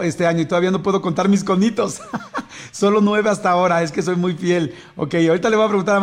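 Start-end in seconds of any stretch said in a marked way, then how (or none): 2.0-2.7 quick high burst of laughter; short laugh. Real, not acted. Host laughing at his own count. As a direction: laugh off the joke.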